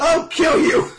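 very high, drawn-out yell; hammed-up crazed threat at full volume; mock threatening scream. Crazed fan threatening the manga author, mock furious scream.

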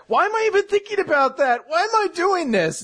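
very high, hammed-up flustered voice; nervous panic. Nervous boy scolding himself while she changes, flustered. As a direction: squeak it out in mock self-reproach. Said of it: "Yueka's kind of nervous".

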